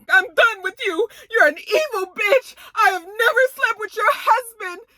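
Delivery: high, tearful outburst through sobs; crying. Retold or imitated. Jacqueline crying as she tells Mia off, sobbing high. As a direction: wail it like a friend betrayed.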